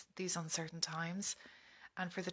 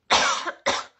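loud hacking cough; real chesty cough, hard and sharp; 16 dB louder than usual. Real, not acted. Coughing fit during prayer.

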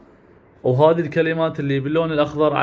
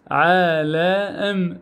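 عالم stretched out very slowly; very slow drawn word; much slower than usual. Shown as a demonstration. Teacher stretching عالم for pupils, very slow. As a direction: hold every sound of the word. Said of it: "أو أقدر أقول عالم".

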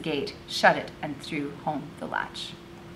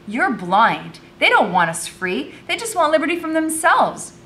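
louder, rising indignant argument; heated accusation; 10 semitones higher, 6 dB louder than usual. A narrator's reading. Girl disputing the boy's talk of freedom, heated. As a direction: argue back hotly, climbing in pitch. Acted quarrel with Curzon.

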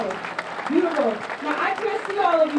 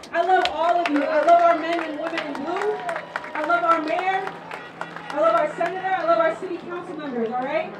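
ordinary talk, nothing marked

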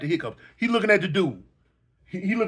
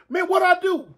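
mock holler; 9 semitones higher than usual.

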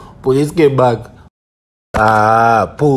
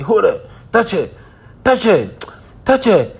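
urgent commands; 8 semitones higher than usual. Retold or imitated. Telling the actors in the clip to grab her, high urgent calls. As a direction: egg them on like a frantic director. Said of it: "And I said".

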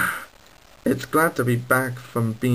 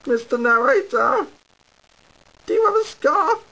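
much higher, pleading little engine; 12 semitones higher than usual. Acted. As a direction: beg the narrator for help. Engine calling to narrator.

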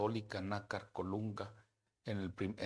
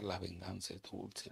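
slow, soft, smug aside; a hint of gloating under the breath; smug thought; 4 dB quieter, slower than usual. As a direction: savor the words like sweet revenge. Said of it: "Yo casi quería pensar".

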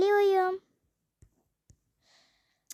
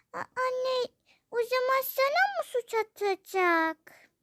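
alarmed; 7 semitones higher than usual. Acted. Daughter alarmed for mother.

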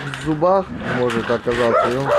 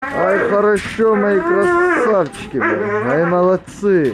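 high, bright praise for the dogs; excited praise; 6 semitones higher, 3 dB louder than usual. Real, not acted. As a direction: gush over good dogs.

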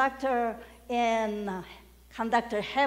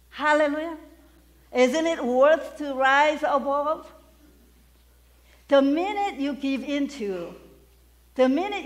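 rallying shout; 5 semitones higher, 6 dB louder than usual. Real, not acted. Preacher rallying congregation.

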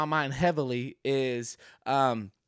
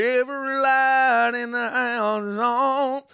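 higher, louder, slow grumbling dad; imitating dad; 9 semitones higher, 7 dB louder, slower than usual. Son mimicking his dad griping every light is on. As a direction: complain like a fed-up father. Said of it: "you know old Trace Hackett".

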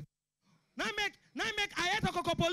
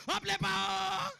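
mock cheering shout; slower than usual. Imitating cheer when light returns.

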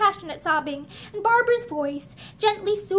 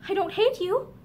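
plain reading voice

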